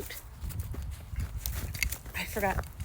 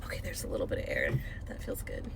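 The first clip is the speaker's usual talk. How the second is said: soft quick whispered relief; relieved hush; 12 semitones lower, 12 dB quieter, faster than usual. Real, not acted. Sweating woman feels the air, whispering relief. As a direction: breathe it out, feeling the cool air. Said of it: "I'm sweating".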